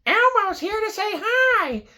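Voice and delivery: high, far-off Elmo-style falsetto; Elmo impression. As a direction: squeak it like a furry puppet. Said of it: "And he goes".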